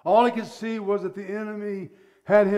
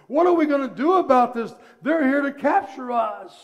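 high, loud, frantic alarm; panicked servant; 5 semitones higher, 6 dB louder than usual. Acted. Servant warns Elisha of the army in loud panic. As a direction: urge him to see the danger, panicking. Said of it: "he just becomes so afraid".

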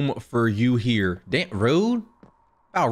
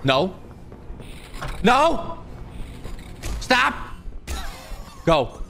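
ordinary talk, nothing marked

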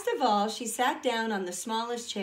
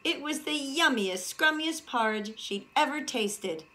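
plain reading voice

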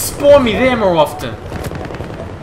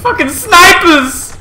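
angry shout; 10 semitones higher, slower than usual. Real, not acted. Angry outburst at snipers.

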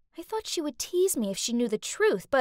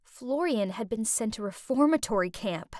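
plain reading voice

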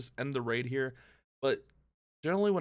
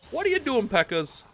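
exasperated yell; 9 semitones higher, 7 dB louder, faster than usual. Real, not acted. Troops going wrong way.

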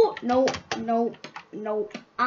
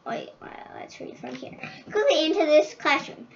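ordinary talk, nothing marked

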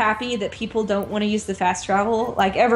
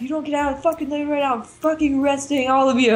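mildly acted angry police officer, pitched up; yelling cop; 5 semitones higher, faster than usual. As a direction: imitate a cop freaking out, short of a real yell. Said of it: "yelling and, like, freaking out".

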